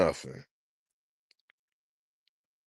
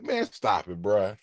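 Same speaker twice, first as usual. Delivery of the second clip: low cool voice; 6 semitones lower, 3 dB quieter than usual. Retold or imitated. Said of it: "He was supposed to be like".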